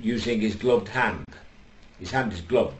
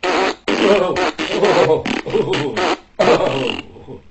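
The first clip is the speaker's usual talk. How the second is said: higher, louder strained groans of oh; mock groaning oh; 8 semitones higher, 8 dB louder, much slower than usual. Comedian joking about his oats, straining groans. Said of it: "that must be six o'clock".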